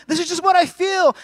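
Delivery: high, loud tearful outburst; imitated wail of an upset wife; mock crying. Wife insisting to her husband, mock crying. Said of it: "She was crying".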